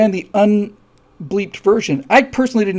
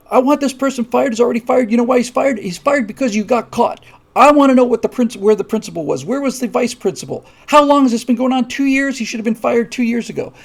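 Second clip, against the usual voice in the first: higher, louder demanding outburst; angry parent; 6 semitones higher, 4 dB louder than usual. Retold or imitated. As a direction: rattle off the demands like an outraged parent, raised up.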